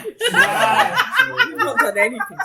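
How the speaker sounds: laughing. Real, not acted. Protesting while laughing.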